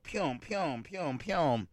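quiet mock injection noises; mouth sound effect. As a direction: softly imitate the pews of a syringe. Imitating the needle injections.

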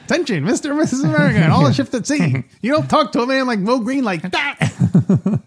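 put-on high, fast old-timey newsreel voice; 1940s radio announcer. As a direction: announce it like a forties broadcast. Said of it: "I always make him sound like a, uh, like a forties, uh, radio broadcast".

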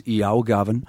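Newcastle greeting in Geordie; Geordie accent. Shown as a demonstration. Newcastle actor's nan greeting Gavin, Geordie accent. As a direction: call to Gavin in broad Geordie. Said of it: "And it goes like this".

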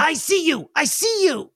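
put-on very high sing-song child voice; a little kid crowing he caught you; kid taunting. GM as the unattended kid, high taunting child voice. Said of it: "And he goes".

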